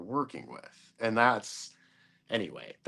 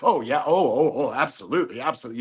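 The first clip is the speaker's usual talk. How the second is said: lightly mimicked eager phone sales voice; a hint of a fawning sales pitch; gushing salesperson; 3 semitones higher, 4 dB louder, faster than usual. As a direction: play the over-eager salesperson agreeing to everything. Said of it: "That's how she was talking".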